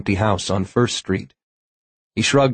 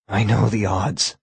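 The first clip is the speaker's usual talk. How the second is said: hoarse. A narrator's reading. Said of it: "Tom said hoarsely".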